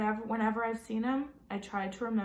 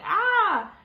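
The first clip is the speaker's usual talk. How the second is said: loud high acted scream; 11 semitones higher, 11 dB louder than usual. Jamie acting out his scream to Mom in the kitchen. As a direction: Jamie shrieks to show it. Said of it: "It was like".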